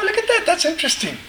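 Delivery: high, laughing mock surprise; amused surprise. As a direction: act out a delighted double take. Amused retold own thought.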